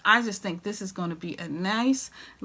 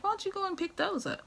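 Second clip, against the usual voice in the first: high, quick, softer imitation; quoting hubby; 12 semitones higher, 7 dB quieter, faster than usual. Hubby suggesting she buy them, imitated high and quick. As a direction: voice the husband's suggestion lightly and raised. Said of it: "He was like".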